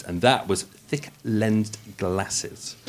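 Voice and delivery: thick lensed glasses said slowly and quietly; slow careful. Shown as a demonstration. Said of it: "I always struggle with words".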